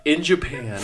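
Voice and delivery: loud laughing through the words; breaking up laughing mid-story. Real, not acted. Laughing retelling the wire transfer.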